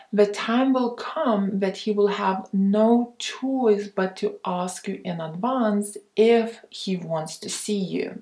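ordinary talk

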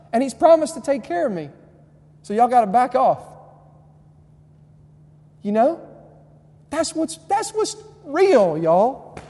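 loud, high, fired-up preaching shout; yelling. Real, not acted. Preacher urging students to tell their parents Jesus knows, yelling. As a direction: belt it out to the back row. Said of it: "I'm through yelling".

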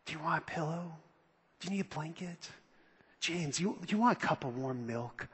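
hushed, soft whisper like tucking someone in; mock-sweet whisper to sleepy disciples; mock tender whisper. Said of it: "what does he say to him".

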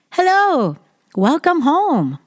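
friendly greeting. Shown as a demonstration. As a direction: greet the family warmly as they come home. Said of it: "このケースなら". (家族を迎える明るくゆっくりな挨拶)

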